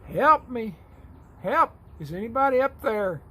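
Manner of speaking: high desperate yells from a cliff edge; man dangling from a cliff, calling for help; yelling for help. Acted. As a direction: shout up the cliff, panicked. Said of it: "He yells out".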